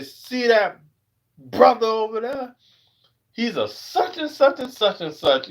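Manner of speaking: high, pointed gossip voice; mocking nosy coworkers. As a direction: imitate someone calling out his job. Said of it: "They say".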